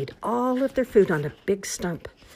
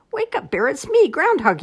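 high, loud wake-up call; 11 semitones higher, 7 dB louder than usual. A narrator's reading. Groundhog waking Bear in his cave, high calling out. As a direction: call into a cave to rouse someone. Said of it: "he called".

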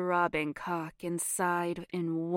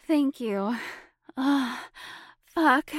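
moaning; 7 semitones higher, 7 dB louder than usual. Acted. Moaning during intimate scene.

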